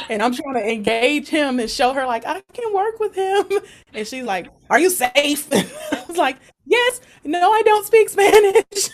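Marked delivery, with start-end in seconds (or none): 2.2-3.4 much higher eager i can work with him; eager reassurance. Retold or imitated. Showing the mom she can handle him, high and eager. 4.7-5.5 grilling mom. Retold or imitated. 6.6-8.9 much higher, louder rushed yes and no; flustered answers. Retold or imitated. Grilled by the mom, flustered, high and loud.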